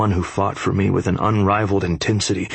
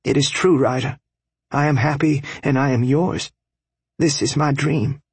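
higher, sincere and fervent; earnest young wife; 7 semitones higher than usual. A narrator's reading. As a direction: vow it with passion. Bella's declaration continues.

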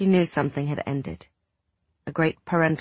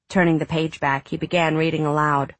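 ordinary delivery, nothing marked

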